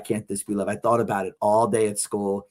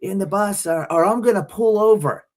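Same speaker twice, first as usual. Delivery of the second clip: raised, warning bus driver; angry bus driver threatening to pull over; 7 semitones higher, 3 dB louder than usual. Retold or imitated. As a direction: scold the kid to get back in. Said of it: "she's like".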